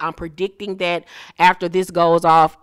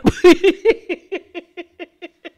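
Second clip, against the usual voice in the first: high cackling laugh; 12 semitones higher, 6 dB louder than usual. Real, not acted. Vlogger cracking up at the pep in step line, loud high cackle.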